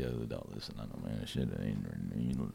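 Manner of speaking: low, mumbled hustler pitch; low street hustler voice. Retold or imitated. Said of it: "Then they came in and was like".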